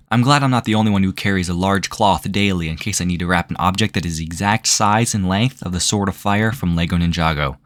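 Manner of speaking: ordinary talk, nothing marked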